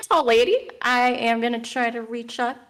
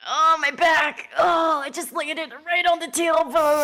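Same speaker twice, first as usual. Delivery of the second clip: slow, raised, achy complaint; groaning in pain; 4 semitones higher, 3 dB louder than usual. Acted. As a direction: groan through a hammed-up sore fall. Character hurt after falling.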